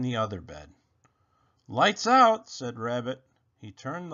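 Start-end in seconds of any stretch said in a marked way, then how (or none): 1.7-2.5 high, loud bedtime call. A narrator's reading. Rabbit calling lights out to Robot, loud. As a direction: announce it cheerfully.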